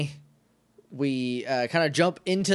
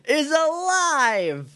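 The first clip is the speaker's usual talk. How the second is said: mock announcer reveal; 11 semitones higher, 3 dB louder, much slower than usual.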